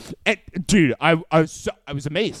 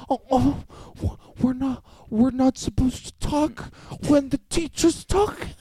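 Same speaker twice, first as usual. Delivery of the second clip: high, incredulous comic whine; Sebastian Maniscalco; 6 semitones higher than usual. Retold or imitated. Scolded chatterers protesting to a classmate, mock-baffled high whine. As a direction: play baffled innocence, climbing to a squeal. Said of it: "They turn into Sebastian Maniscalco".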